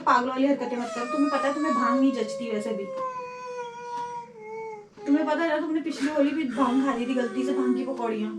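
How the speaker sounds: ordinary talk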